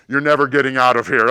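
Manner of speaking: very loud, menacing proclamation without raising pitch; booming put-on voice. Hidden man frightening a stranger in the grave, booming voice. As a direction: bellow it like a voice from the grave. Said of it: "in the voice that I said it".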